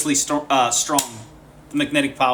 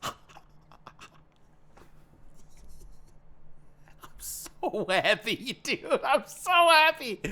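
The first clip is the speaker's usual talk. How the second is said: laughing, higher and breathless with delight; overjoyed laughing gush; giddy laughing joy; 8 semitones higher, 4 dB quieter, slower than usual. Real, not acted. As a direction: gush through giggles at the new gear. Thrilled over new mic.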